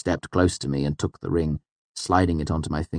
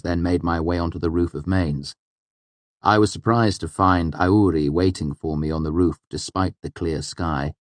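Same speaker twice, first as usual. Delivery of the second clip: ordinary delivery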